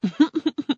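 short high chuckle of the gatekeeper; a quick amused laugh; light mocking laugh. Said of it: "The guardian of the gate laughed".

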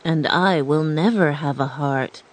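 lightly voiced sorrowful Tin Man; Tin Man's voice. A narrator's reading. Tin Man despairing, lightly voiced and sorrowful. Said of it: "said the Tin Man".

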